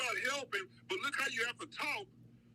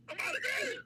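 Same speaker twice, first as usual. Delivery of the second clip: desperate shout; 13 semitones higher than usual. Retold or imitated. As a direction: yell it at the cop, close to tears. Acted shout at the cop.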